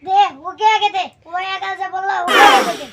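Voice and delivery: loud, insistent give it; loud demand. Acted. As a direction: bark the order to pour. Demanding drink for friend loudly. (জোরে দাবি)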